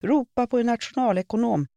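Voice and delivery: high, loud, demanding call; old lady shrieking her demand. A narrator's reading. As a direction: order it shrilly across the shop. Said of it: "skrek en äldre dam, vars röst var gäll och krävande".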